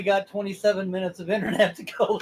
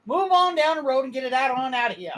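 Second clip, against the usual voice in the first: put-on southern accent; 9 semitones higher, 6 dB louder than usual. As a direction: shoo him off like a Southern sheriff. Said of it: "Southern accent".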